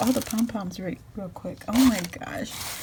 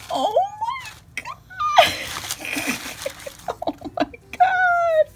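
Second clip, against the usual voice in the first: squealing delight. Real, not acted. Opening a friend's gift, she shrieks with delight. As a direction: shriek as the surprise hits. Gasping at handmade pom-poms.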